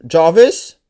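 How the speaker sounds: loud called-out name to a voice assistant; calling out a wake word. Real, not acted. Calling Jarvis to wake the helmet, loud and clear.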